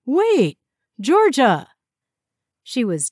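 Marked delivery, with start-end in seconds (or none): 0.0-1.7 calling after her, loud and high; urgent call across the room. Acted. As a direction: shout after someone leaving. Calling after her as she leaves.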